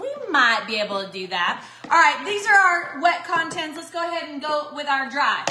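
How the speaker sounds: ordinary talk, nothing marked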